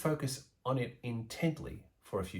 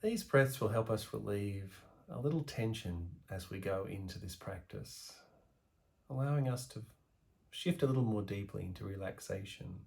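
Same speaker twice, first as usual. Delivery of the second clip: guided meditation; 5 dB quieter than usual. Acted. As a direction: ease the listener into calm, letting the voice sink softer. Guided meditation practice begins.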